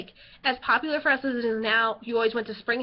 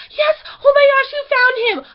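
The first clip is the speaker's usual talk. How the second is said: much higher and louder, squealing with relief; overjoyed relief; 11 semitones higher, 10 dB louder than usual. Retold or imitated. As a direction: shriek it like the lost brother just came back. Guard returns the lost brother.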